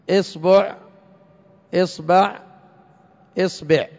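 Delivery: "إصبع" as esbuʕ, esbaʕ, esbeʕ, hamza kept on i; hamza with kasra. Shown as a demonstration. Teacher to class, showing the kasra hamza with the three b vowels. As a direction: hold the i in "إصبع" and change the b vowel. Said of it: "مكسورة".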